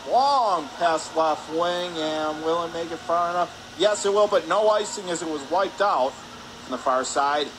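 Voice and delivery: normal talking voice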